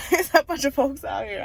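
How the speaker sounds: laughing through the corrected folks line; giggling as she says folks. Shown as a demonstration. Laughing at her own word to viewers.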